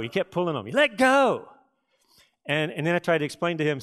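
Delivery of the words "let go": put-on much higher, louder child voice; a toddler insisting, pitched way up; insistent little son. Child keeps pulling, repeating.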